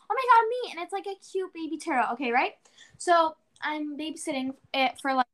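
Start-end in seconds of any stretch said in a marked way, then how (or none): 0.1-0.7 eager high squeal of volunteering; excited. Retold or imitated. Girl volunteering to her teacher, excited. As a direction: jump at the chance, thrilled.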